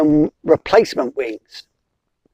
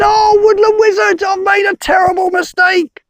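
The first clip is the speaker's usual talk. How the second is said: frantic bumblebee; 9 semitones higher than usual. Acted. Bee pleading with the wizard, high and panicky. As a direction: squeak it out like a desperate bee.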